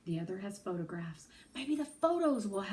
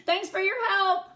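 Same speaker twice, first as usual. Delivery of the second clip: high, loud parting call over the shoulder; 11 semitones higher, 10 dB louder than usual. Acted. Girl calls thanks to the clerk while fleeing. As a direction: call it back while running out. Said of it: "I call".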